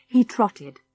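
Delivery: plain reading voice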